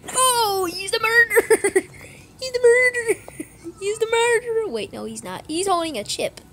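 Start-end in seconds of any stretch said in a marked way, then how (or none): 0.1-4.7 loud excited call; excited shout. Real, not acted. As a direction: shout out the accusation. Excited accusation in game.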